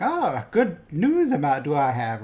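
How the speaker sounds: lightly voiced batty old hermit, slow; a hint of the crazy hermit, slowed down; crazy hermit Yoda. Podcaster imitating Yoda as crazy hermit, lightly voiced. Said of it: "talking like crazy hermit".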